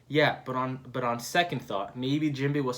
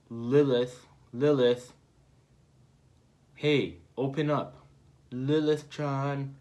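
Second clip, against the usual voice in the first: calling out; slower than usual. Acted. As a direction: call her name through a wall. Calling through the wall.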